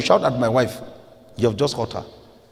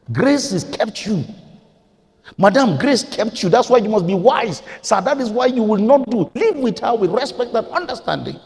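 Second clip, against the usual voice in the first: forceful preaching; 9 semitones higher, 3 dB louder than usual. Real, not acted. Preacher urges husbands and wives to live by grace, preaching forcefully. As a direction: press each command home from the pulpit.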